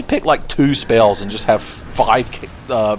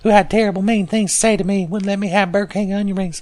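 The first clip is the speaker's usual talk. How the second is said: clingy, high-pitched ghost voice; Gower; 9 semitones higher than usual. Acted. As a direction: pitch it high as a needy, pointed complaint. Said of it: "you're so clingy".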